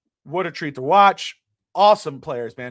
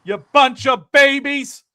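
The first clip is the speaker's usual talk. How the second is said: loud high yell of contempt; shouting down the haters with scorn; angry shout; 8 semitones higher, 11 dB louder, much slower than usual. Real, not acted. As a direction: tell the whiners to shut up. Scolding the critics.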